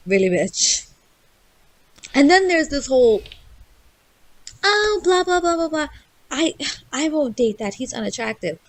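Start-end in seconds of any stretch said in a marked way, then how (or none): 4.6-8.6 high-pitched mock complaint, easing back down at the end; mocking whine. Mimicking women who reject nice guys, mocking. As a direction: imitate a picky dater in a higher voice.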